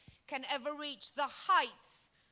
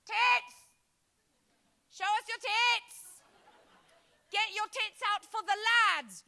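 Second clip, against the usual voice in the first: loud, higher builder yell; builder shout; 8 semitones higher, 6 dB louder than usual. Retold or imitated. Builders shouting at a woman passing by, loud yell. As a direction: bellow it across a building site. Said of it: "they only ever shout one thing".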